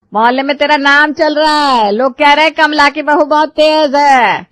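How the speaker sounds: loud, high accusing scold; angry scolding. Acted. Mother-in-law scolding her daughter-in-law, loud and angry. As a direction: throw the gossip in her face, loudly.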